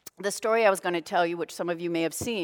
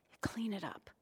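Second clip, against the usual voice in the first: whisper; 13 dB quieter than usual. Shown as a demonstration.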